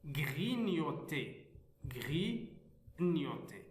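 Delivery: slow, drawn-out model pronunciation of grignoter; word demonstration. Shown as a demonstration. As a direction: say grignoter slowly, stretching each part. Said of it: "Alors le premier mot".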